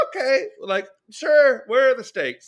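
mock nonchalance. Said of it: "They're like".

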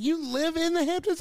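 high-pitched incredulous question; retold disbelief. Viewer scoffing at rich kids' show, incredulous. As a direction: question it high, in mock astonishment.

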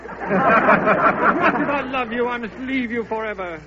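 slow, loud melodrama declaration; a hero leaving forever, hammed-up; mock tragic farewell. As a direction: ham up a noble goodbye. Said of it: "you be Stanislaus".

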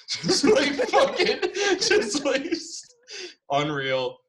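high laughing words; giggling at the punchline. Real, not acted. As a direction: laugh through it. Laughing at his own story.

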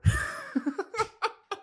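sudden high squealing laugh. Real, not acted. Vlogger cracking up at his own sweatpants joke, high laugh. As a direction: burst out in a high giggle.

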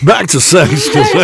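loud, high laughing quip; loud laughing topic switch. Real, not acted. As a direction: laugh it out as a joke. Jokey topic change.

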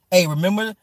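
normal talking voice

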